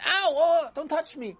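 high pained cry, ow. Acted. Beaten boy cries out to his friend, high and hurt. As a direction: cry out in pain at a touch. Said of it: "and Yossi screamed".

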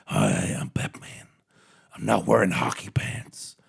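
Batman voice. Retold or imitated. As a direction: growl it like a mock Batman. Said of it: "I'm gonna try and do a Bat".

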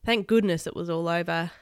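ordinary delivery

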